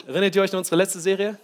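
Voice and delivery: usual speaking voice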